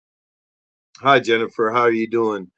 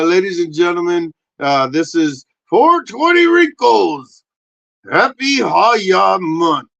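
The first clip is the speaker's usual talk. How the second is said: slow, loud showman intro; announcer intro; 4 semitones higher, 7 dB louder, slower than usual. Acted. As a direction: announce it like a host.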